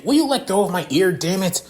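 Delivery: high, quick, irritated yelp; exasperated outburst while being tugged; pained protest. Acted. Leon's ear pulled, wincing in pain.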